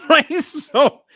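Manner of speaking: bursting into a high, loud laugh. Real, not acted. Dad laughing to viewers about his daughter's answer, laughing loudly. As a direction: laugh it out in disbelief.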